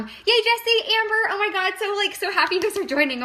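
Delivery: high, loud, delighted welcome to viewers; gushing over new viewers popping into the stream; excited greeting. Real, not acted. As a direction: squeal hello to friends joining the live. Greeting live viewers as they join.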